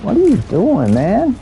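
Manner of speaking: high, incredulous gamer complaint; exasperated, pitch jumping way up; real exasperation. Real, not acted. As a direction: call out a teammate's blunder in disbelief. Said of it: "it drives me crazy".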